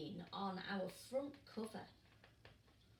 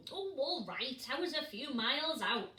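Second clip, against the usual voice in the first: louder, offhand excuse; sheepish, louder shrug; shrugging dad penguin; 2 semitones higher, 6 dB louder than usual. Acted. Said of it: "shrugged Mister Pilchard Brown".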